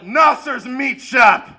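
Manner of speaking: loud slow shout of a store name; slow proud shout. Retold or imitated. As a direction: bellow it out slowly.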